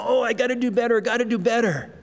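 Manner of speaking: lightly acted fretting at oneself; mock self-pressure. A believer wrestling with their own shortcomings, mock fretting.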